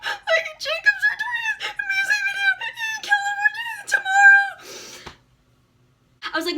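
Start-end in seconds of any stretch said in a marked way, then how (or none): 0.0-5.1 sobbing high-pitched cry; girl phoning mom on a boat, sobbing and shouting. Retold or imitated. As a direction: wail it through tears, much higher. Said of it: "crying so hard".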